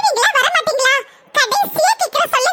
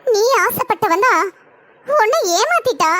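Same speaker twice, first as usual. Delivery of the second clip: put-on comforting older sister; 6 semitones lower than usual. Older sister consoling the crying bride, in a low soothing voice. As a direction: soothe her, tell her not to cry.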